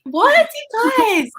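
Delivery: high, loud, drawn-out disbelief; incredulous outcry. Real, not acted. Australian scolding her co-host for not knowing the stars, incredulous. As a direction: cry out in playful outrage, stretching the words.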